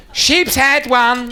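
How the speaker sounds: very slow, high order call. Acted. Counter girl relays one sheep's head, high drawn-out call.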